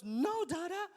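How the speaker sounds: put-on high, insistent toddler voice; mock tantrum squeal; defiant child. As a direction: mimic a small kid defying his father. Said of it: "he goes even more crazy".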